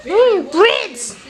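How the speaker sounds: high-pitched mock delight; excited squeal. Mimicking toddler's delight at bread.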